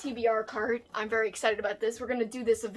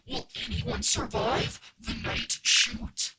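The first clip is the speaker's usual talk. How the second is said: slow, ominous horror-trailer voice; spooky tagline read; slower than usual. Acted. Reading a horror book blurb to viewers, spooky and slow. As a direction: draw it out menacingly.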